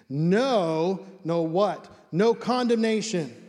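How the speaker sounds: "No" loud and set apart by pauses; emphatic NO. Shown as a demonstration. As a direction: hit each "no" hard, landing on "No condemnation". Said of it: "It's an emphatic no".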